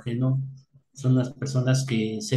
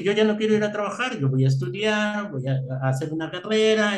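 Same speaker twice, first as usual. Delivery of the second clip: higher-pitched, quick self-quote; retold eager promise; 7 semitones higher than usual. Son telling his mother he'll study, eager retold promise. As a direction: retell a youngster's big plans, pitched up.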